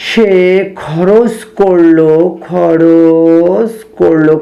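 slow, stretched words with long gaps; halting, level write-along; dictating while writing. Real, not acted. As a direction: draw out each word as the pen moves. Writing the statement word by word.

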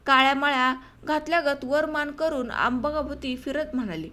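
ordinary delivery, nothing marked

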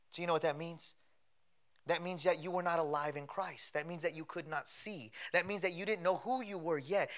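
ordinary delivery

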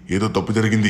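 holy man crying out that something went wrong; alarmed cry. Acted. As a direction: repeat it as a real alarm. Repeat, escalated alarm.

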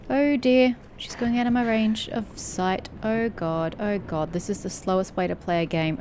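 ordinary delivery

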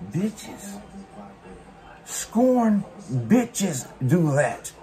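very slow, contemptuous; scornful drawl. Real, not acted. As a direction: drag it out with disdain. Scornful dismissive verdict.

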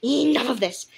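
fed-up yell at a squabbling group; growled shout. Acted. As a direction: shout the crowd down. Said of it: "suddenly growled and shouted at the cats".

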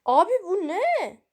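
real startled cry of disbelief; startled shock. Real, not acted. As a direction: jolt up in alarm at what you see. Sudden strange thing appears in game.

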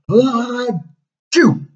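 high, loud whale sneeze; the giant whale sneezing them out, hammed-up; big sneeze. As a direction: build the sneeze then blast it.

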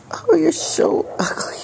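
squeaky, slow, whispered pet talk; high cooing whisper to pet. Acted. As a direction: coo affectionately at the cat. Cooing at the sneezing cat.

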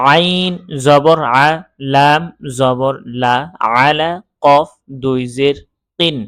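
slow, firm syllable-by-syllable recitation; spelling drill. Shown as a demonstration. Teacher leading learners through double zer, slow spelling chant. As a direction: sound out each letter, then join them, unhurried.

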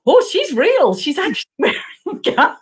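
high-pitched, laughing disbelief; amused freak-out. Retold or imitated. Writer feeling the agent's gun mid-hug, amused freak-out. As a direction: replay the shock high, with a laugh. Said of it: "it was just a big freak out for me".

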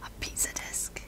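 hushed, breathy whisper of wonder; awed whisper. Real, not acted. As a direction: whisper it like a rediscovered treasure. Amazed at a childhood find.

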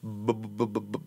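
soft, low repeated b sounds; imitated stutter. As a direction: imitate getting stuck on a b. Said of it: "I would be like".

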